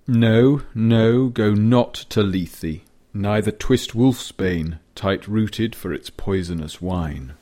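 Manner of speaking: loud, slow and impassioned verse; dramatic poetry recitation. Acted. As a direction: declaim the opening like a stage poet. Recites poem opening dramatically.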